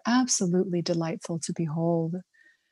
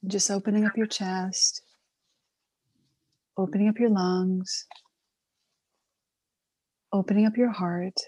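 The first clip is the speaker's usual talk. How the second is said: guided meditation; faster than usual. Acted. Guided breathing opening records.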